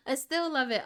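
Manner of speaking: laughing through I still love it. Real, not acted. Judge laughs, admitting she still loves night shots. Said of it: "I've said it before".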